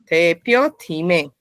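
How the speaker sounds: "Tepyo time" slowly, split as "Tepyo" and "time"; two drawn-out beats on "Tepyo time". Shown as a demonstration. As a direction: pause between "Tepyo" and "time". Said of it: "separar la entonación de dos en dos".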